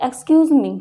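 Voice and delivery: loud call to get someone's attention. Shown as a demonstration. Teacher demos calling out to a stranger, loud call. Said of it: "जैसे example आप देखिए".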